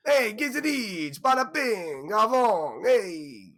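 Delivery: loud, high-pitched hammed-up Italian-American accent; Tony Soprano. Host shows off his Italian accent, broad mock Italian-American accent. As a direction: shout it as a caricature Italian-American mobster. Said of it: "My name is Tony Soprano".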